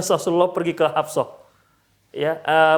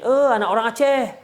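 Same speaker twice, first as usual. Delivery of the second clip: raised, louder-pitched teasing jibe; mock taunt; 5 semitones higher, 4 dB louder than usual. People trading ethnic insults, mocking. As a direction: toss it out like a jeer. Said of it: "kalau ada saling ejek mengejek".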